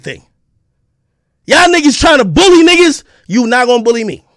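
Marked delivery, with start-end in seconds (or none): none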